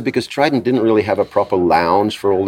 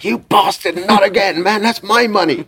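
high, mock-outraged accusation; Lemmy; 13 semitones higher than usual. Gambler turns on the man who took his winnings, mock outrage.

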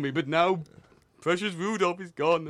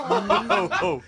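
tickled giggle; 5 dB louder than usual. Pretend. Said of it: "What happens if I tickle his belly?".

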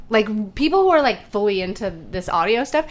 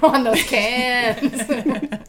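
drawn out; much slower than usual. Acted. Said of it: "do you like how I keep calling them cans".

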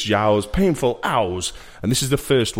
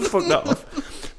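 laughing; 12 semitones higher, slower than usual. Real, not acted.

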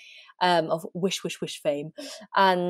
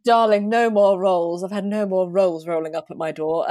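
posh diva voice; 4 dB louder than usual. Pretend. Posh celebrity put-on.

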